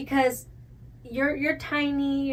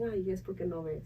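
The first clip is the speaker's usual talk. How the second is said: a lower, softer motherly tut; mom's voice; 7 semitones lower, 14 dB quieter than usual. Retold or imitated. Imitating her mom's excuse about her falls, lower and soft. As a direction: quietly imitate a mother clucking over her.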